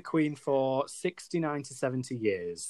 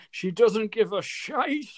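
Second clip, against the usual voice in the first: put-on high, drawn-out Irish accent through laughter; 9 semitones higher, 4 dB louder, slower than usual. Retelling an old lady's reaction to her card, Irish accent. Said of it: "in this gorgeous Irish accent".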